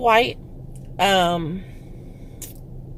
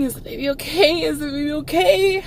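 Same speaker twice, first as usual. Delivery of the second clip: anxious pleading; 5 semitones higher than usual. Retold or imitated. Frantic mother after silent birth.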